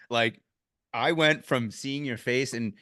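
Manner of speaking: normal talking voice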